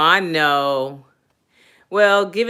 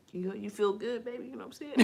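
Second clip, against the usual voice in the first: seductive murmur; 5 semitones higher, 14 dB quieter, faster than usual. Pretend. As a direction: murmur it like pillow talk. Acting out bedroom talk.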